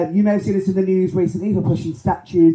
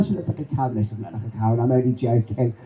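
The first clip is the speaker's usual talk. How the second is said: teasing aside; 9 semitones lower, 5 dB quieter, faster than usual. Real, not acted.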